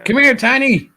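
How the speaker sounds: high, loud call to a dog. Real, not acted. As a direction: call the little dog over sweetly. Calling the dog over.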